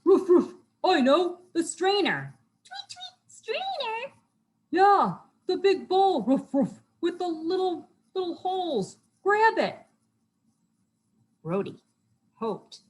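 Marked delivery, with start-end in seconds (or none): 0.0-2.4 high, loud barking dog voice; woof, then blurt the bright idea; eager cartoon dog; excited dog. A narrator's reading. Dog character has idea. 2.6-4.1 very high, small chirpy voice; tiny questioning cartoon bird; puzzled bird. A narrator's reading. Puzzled bird character in story. 4.7-9.8 high, excited doggy voice; eager cartoon dog giving orders; urgent dog. A narrator's reading. Dog character urging friends.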